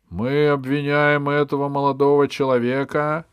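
loud, high declamation; ringing public accusation; bishop's formal accusation. Acted. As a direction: proclaim the charge like a prosecutor before the court. Bishop formally reads out the charge.

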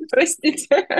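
apologizing through nervous laughter. Real, not acted. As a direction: giggle nervously while saying sorry. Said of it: "Это нервный смех". (нервный смех с извинением)

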